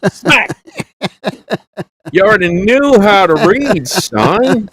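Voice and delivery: high-pitched hillbilly father scolding his son; dad back from down south demanding what his college son learned, in a high hillbilly voice; Jed Clampett dad. Retold or imitated. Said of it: "you sounded like there was Jed Clampett and Jethro".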